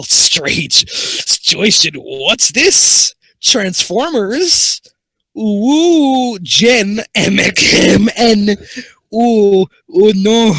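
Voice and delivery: loud, high-pitched excited squeals. Real, not acted. Discovers Transformers fan fiction.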